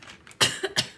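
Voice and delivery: real throaty cough. Real, not acted. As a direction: cough hard mid-sentence. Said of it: "excuse me".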